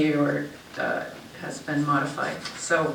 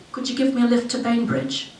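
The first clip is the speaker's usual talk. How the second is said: higher-pitched, lightly British hitchhiker; scruffy British accent; 7 semitones higher than usual. A narrator's reading. Hitchhiker asks driver for a lift, scruffy British accent. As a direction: ask it with a touch of scruffy British. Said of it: "in his scruffy British accent".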